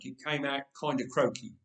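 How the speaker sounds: plain reading voice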